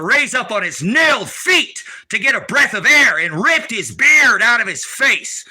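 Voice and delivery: loud slow preaching shout; passionate shout. Real, not acted. Preaching about the crucifixion, a slow loud roar. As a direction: roar out the suffering slowly.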